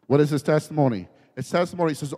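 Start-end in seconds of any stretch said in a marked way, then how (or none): none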